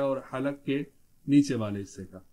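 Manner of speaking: ordinary talk, nothing marked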